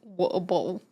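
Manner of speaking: "Water bottle" said British style, "wa-uh bo'l" with dropped t; British pronunciation. Shown as a demonstration. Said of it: "makes my brain go burr to say water bottle".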